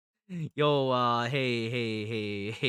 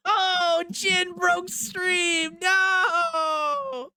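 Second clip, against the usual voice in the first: shocked laughing cry; 14 semitones higher, 3 dB louder, much slower than usual. Real, not acted. Stream crashes, laughing in disbelief.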